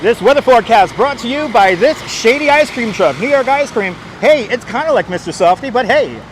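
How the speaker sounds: loud, punchy sponsor plug; radio ad voice. Pretend. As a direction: sell it like an old radio ad. Mock sponsor read, loud.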